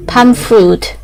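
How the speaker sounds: normal talking voice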